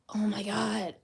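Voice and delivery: breathless whispered oh my God. Real, not acted. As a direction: hiss it out through the pain. Reacting to the painful cut.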